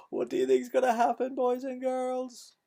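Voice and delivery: put-on high, teasing question to children; excited question. Storyteller asking the children, high and excited. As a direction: lean in and ask the kids, wide-eyed. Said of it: "asks the children excitedly".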